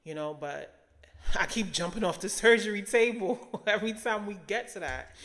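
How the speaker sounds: chuckling at herself while praying; laughing prayer. Retold or imitated. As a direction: laugh through the confession. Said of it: "And I'm like".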